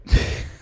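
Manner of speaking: short burst of laughter. Real, not acted. Streamer seeing Google's answer, sudden laugh.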